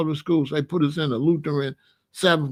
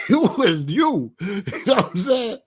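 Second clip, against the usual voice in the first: breaking up mid-story; laughing; 4 semitones higher than usual. Real, not acted. Comic recalling confusion at mama's other self, laughing. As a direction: laugh through the words.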